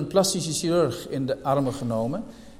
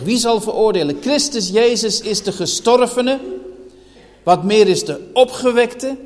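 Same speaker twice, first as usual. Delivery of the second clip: raised, ringing pulpit voice, higher and louder; preaching at full force; 3 semitones higher, 5 dB louder than usual. Acted. Preacher pressing the church with questions, full-force preaching. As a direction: hammer each rhetorical question home to the hall.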